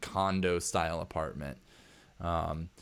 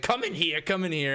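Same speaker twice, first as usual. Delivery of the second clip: high, insistent repeated command; urgent order; 9 semitones higher, 4 dB louder than usual. Acted. Hacker ordering the stranger into his living room, high impatient command. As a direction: usher them in impatiently, pitched up.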